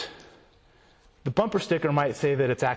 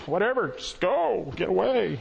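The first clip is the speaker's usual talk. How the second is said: mock couldn't-care-less parents, drawn out and higher; dismissive parents; 6 semitones higher, slower than usual. Today's parents sending their kid off, mock dismissive. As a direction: shoo the kid off, exaggerated and slow. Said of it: "parents are like".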